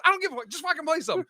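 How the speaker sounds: high, very fast panicked plea; retold freak-out. Bombing comic begging the DJ for music, high frantic rush. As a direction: imitate a flustered comic rushing the words. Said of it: "like, freaking out".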